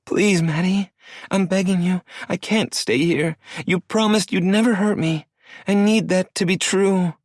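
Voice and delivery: put-on high girlish voice, sniffling no; tearful young woman. Star crying, refusing Maddie's misunderstanding, high and tearful. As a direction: shake your head through tears. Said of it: "Her pleas".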